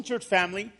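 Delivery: ordinary talk, nothing marked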